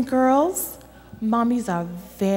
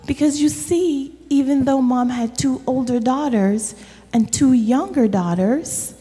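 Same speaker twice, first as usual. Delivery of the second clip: normal talking voice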